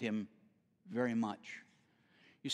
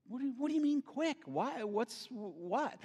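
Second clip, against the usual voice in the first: baffled; 9 semitones higher than usual. Retold or imitated. His baffled reply to ex-wife.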